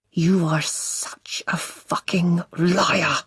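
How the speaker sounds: slow, strangled, breathless rage. A narrator's reading. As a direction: choke the words out in fury. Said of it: "His voice sounds strangled".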